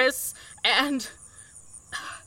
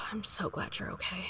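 unvoiced relieved whisper; tender whisper; 6 semitones lower, 14 dB quieter than usual. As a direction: breathe it out with relief.

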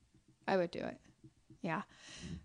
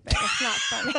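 guilty laughter through the words; high, helpless real laughter breaking in; laughing; 6 dB louder than usual. Real, not acted. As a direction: crack up while insisting it's not funny. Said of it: "I'm laughing".

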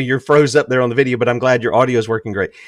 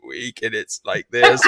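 put-on old granny voice; 4 semitones lower than usual. Shown as a demonstration. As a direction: croak it out like a frail granny. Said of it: "I call it the old granny voice".

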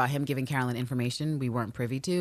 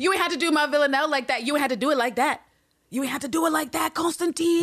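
indignant scolding; 9 semitones higher than usual. Real, not acted. Host scolding Constantine for how he spoke to Villanelle. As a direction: scold him for hurting her.

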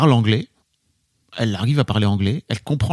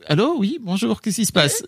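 higher-pitched mock phone greeting; acted phone answering; 8 semitones higher than usual. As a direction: play answering an unknown caller. Joue sa réponse au téléphone. (imitation au téléphone, voix plus aiguë)

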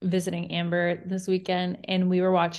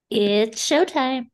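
drawn-out announcer-style intro, louder and higher; showy put-on voice; 5 semitones higher, 4 dB louder, slower than usual. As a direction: stretch it like a show host's opening. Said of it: "what that was in that voice".

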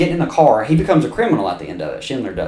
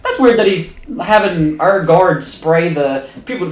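puzzled guards; 6 semitones higher, 4 dB louder than usual. Retold or imitated. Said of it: "They're like".

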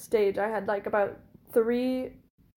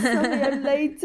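imitated French accent, higher-pitched; thick French accent; 4 semitones higher than usual. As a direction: put on a French accent for a mock apology. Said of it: "he has a really thick French accent".

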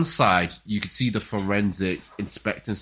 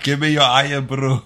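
higher, louder mock Scottish demand; playful Scottish put-on, louder; 5 semitones higher, 8 dB louder than usual. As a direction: demand the Irn-Bru in a hammed-up Scottish voice. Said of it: "She's like".

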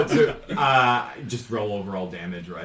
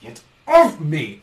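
loud, slow, angry yell; angry shout; 5 semitones higher, 9 dB louder, slower than usual. Acted. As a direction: shove them off with the voice. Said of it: "and he says".

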